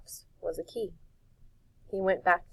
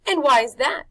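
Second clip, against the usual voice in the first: loud, higher showman; Rictus voice; 7 semitones higher, 11 dB louder than usual. A narrator's reading. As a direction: ask it loudly.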